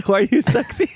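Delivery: high, teasing "why are you sexy"; mock sexy squeal. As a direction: squeal it in jest.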